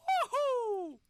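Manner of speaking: high, loud whoop of joy; excited cheer. Real, not acted. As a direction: cheer the launch on. Cheering the rocket launch.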